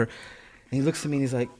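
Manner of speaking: ordinary delivery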